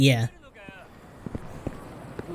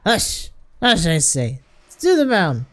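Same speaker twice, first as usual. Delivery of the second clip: put-on higher, slurred drunken order; bossy drunk; 5 semitones higher, slower than usual. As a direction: shush them and take charge, drunkenly.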